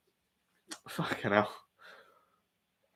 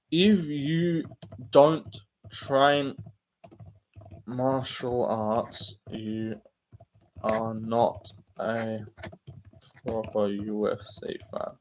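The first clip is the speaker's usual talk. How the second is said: slow emphatic poll read; 4 semitones lower, 5 dB quieter, much slower than usual. Real, not acted. Spells out the poll slowly.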